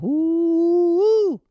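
very high, sustained "woo"; drawn-out "woo". Retold or imitated. Vlogger reacting to Keith's jab at Dan, high "woo". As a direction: let out a long high falsetto "woo". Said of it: "I'm like".

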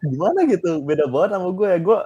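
laughing disbelief; amused laughing reaction. Real, not acted. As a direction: exclaim it while laughing. Reacting laughing to her answer.

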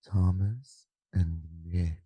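slow, soft, breathy podcast greeting; ASMR voice. Pretend. [name] performing an ASMR intro on request, slow and soft. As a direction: ease into it like an ASMR video. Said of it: "let's hear it anyway".

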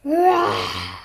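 loud, sudden beastly roar; bear roar. Acted. Real bear bursts upon the animals, loud roar. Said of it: "roared the absolutely enormous real bear".

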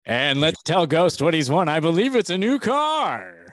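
booming game show host voice; prize announcer. Pretend. Game show prize reveal parody.

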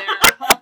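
loud burst of laughter. Real, not acted. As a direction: laugh loudly at the joke. Laughing at meme.

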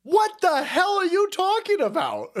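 what on earth is he saying; incredulous. Real, not acted. Reacting to absurd claim.

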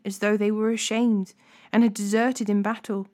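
plain reading voice

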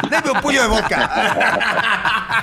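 slow, drawn-out order at the bar; mock drinker ordering. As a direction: ham up a thirsty drinker asking for vodka. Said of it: "Él pide puños". (imitando a un borracho que pide vodka)

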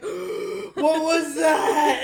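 loud, drawn-out startled cry; hammed-up scare; acted fright. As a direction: gasp it out in mock alarm, high and stretched. Retold scare in the cat room.

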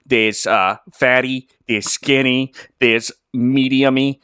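deadpan roll-call in a mock Boston accent; put-on Boston dog owner; imitated Boston accent. Connecticut man explaining his dogs' names, imitated Boston accent. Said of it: "that's what he sounded like".

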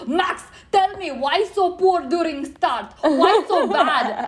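put-on loud, high, slow Italian accent; over-the-top Italian. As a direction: ham up an excitable Italian interviewer, big and drawn out. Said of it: "it's the most Italian man I have ever".

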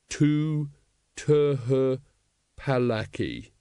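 slow word-by-word stumbling read; halting misreading. A narrator's reading. Hero reading the poster aloud, halting. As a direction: sound out each word like a poor reader.

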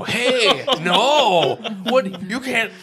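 high, loud mock outrage; retold scolding. Mom and grandma both stopping the child, scolding. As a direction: imitate shocked adults cutting a child off. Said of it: "met with, like, scolding and anger".

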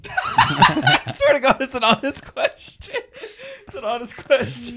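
high squeaky laughing protest. Real, not acted. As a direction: laugh through the words. Laughing while asking question.

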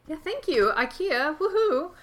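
a high, bright little whoop; quick excited woohoo; cheer. Real, not acted. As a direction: cheer the new shelves. Cheering about new bookcases.

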